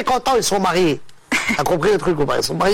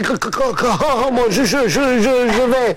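stammering. Acted. Stuttering man telling his friend he seeks work, stammering. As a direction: repeat the first syllables, stuck on each word. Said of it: "C'est un mec qui bégaye".

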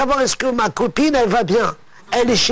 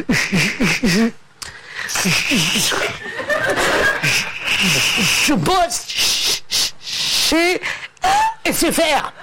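higher, long stops between stuck words; stammering; 3 semitones higher, slower than usual. Acted. Stuttering man naming his new job, stammering. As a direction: stutter it out, pausing long on each word. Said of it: "C'est un mec qui bégaye".

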